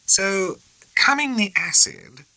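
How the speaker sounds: high, slow, amused; proper officer. A narrator's reading. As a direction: turn the slang over slowly on the tongue. Morrell tasting the phrase.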